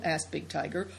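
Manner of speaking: ordinary delivery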